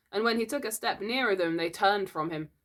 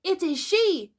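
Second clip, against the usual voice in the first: high, loud discovery; amazed cry; 12 semitones higher, 6 dB louder, slower than usual. A narrator's reading. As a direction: cry out in wonder at what you see. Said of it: "he cried".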